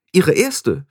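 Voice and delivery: high, self-important boast; proud. A narrator's reading. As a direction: add it with obvious pride. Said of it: "fügte Anagramma stolz hinzu". (stolz, mit hoher Stimme)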